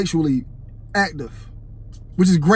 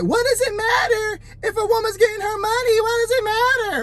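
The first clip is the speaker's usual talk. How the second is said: mock yelling woman; 13 semitones higher than usual. Retold Amber yelling in interview.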